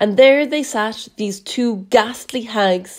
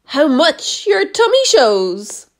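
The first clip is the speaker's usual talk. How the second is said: higher, slow, mocking aunt; spiteful jibe; 7 semitones higher, 4 dB louder, slower than usual. A narrator's reading. Aunt Spiker taunting Sponge, slow higher sneer. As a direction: needle her sister slowly. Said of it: "Spiker cried".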